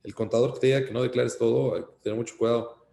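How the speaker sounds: normal talking voice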